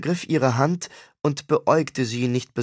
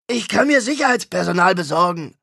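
higher, pushy star insisting; stubborn rock star; 6 semitones higher, 4 dB louder than usual. Acted. Star insisting he can hire bodyguards, stubborn. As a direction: dig in like a spoiled celebrity. Mosch argues with brother.

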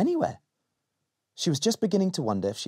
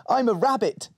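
put-on little girl voice; 10 semitones higher, 3 dB louder than usual. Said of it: "said Molly".

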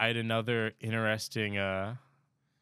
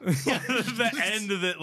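laughing; 9 semitones higher, 3 dB louder, much slower than usual. Real, not acted. Laughing while talking.

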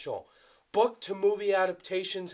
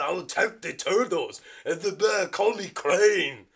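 louder put-on character voice; Crane voice; 4 dB louder than usual. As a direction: deliver it as Crane. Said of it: "my Crane impression".

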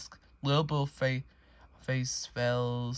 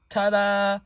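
higher, louder ta-da; triumphant reveal; 6 semitones higher, 7 dB louder than usual. Acted. Little Bill showing his room to Mama, triumphant reveal. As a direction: present it with a loud flourish.

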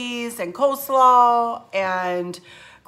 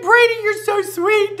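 high, choked-up gush of thanks; a touch of tearful high-pitched sweetness; teary gush; 12 semitones higher, 6 dB louder than usual. Retold or imitated. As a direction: say it like you're already tearing up. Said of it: "I had already cried".

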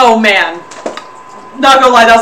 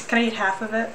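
ordinary delivery

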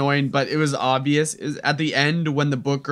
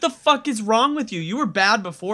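mock outrage; 7 semitones higher than usual. Ranting at the character's behavior.

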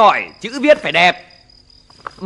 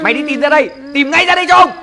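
angry order; 6 semitones higher, faster than usual. Acted. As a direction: bark the order at the child. Father ordering child. (ra lệnh quát tháo)

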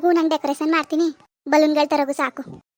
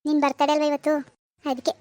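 ordinary talk

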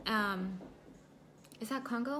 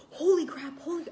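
louder retold cry of pain; shocked outburst; 6 semitones higher, 5 dB louder than usual. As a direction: blurt it out as the pain hits. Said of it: "and I was like".

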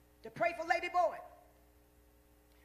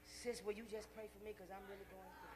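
much softer, low, deflated asking; mock weary plea; 5 semitones lower, 8 dB quieter than usual. Preacher mimicking a drained believer asking sis for prayer. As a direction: sound drained, begging for prayer.

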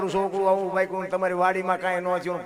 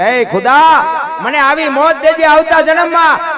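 much higher and louder, slow pleading cry to God; fervent prayer; 8 semitones higher, 10 dB louder than usual. Acted. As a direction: lift the plea up to heaven. Sikandar prays to God.

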